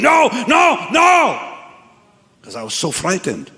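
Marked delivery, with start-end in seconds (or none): 0.0-1.4 screaming. Retold or imitated. Young man answers the massive voice, screaming. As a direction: cry it out in fright. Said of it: "As I'm screaming".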